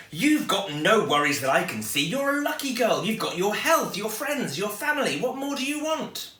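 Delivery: a cheery mum who can't see the problem; brisk mum. Acted. Mum voiced in story.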